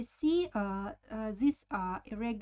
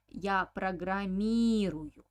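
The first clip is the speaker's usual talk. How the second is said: программирую with a long, louder МИ; stress on И; 5 dB louder than usual. Shown as a demonstration. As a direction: lean hard on the И in программирую. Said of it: "So, accent falls on E".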